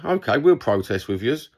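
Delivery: ordinary delivery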